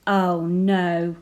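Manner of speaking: ordinary delivery, nothing marked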